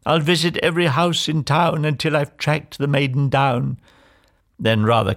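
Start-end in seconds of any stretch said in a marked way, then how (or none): 0.1-3.8 higher, declaiming princely vow; sustained, raised storybook proclamation; proclaiming cry. A narrator's reading. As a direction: swear it to the whole town. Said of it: "he cried".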